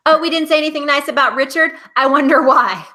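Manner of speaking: high, loud sarcastic jab; mock sarcasm. Host mocking the Richard fans, loud and sarcastic. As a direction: needle the fans with fake innocence. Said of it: "If you're a Richard stan".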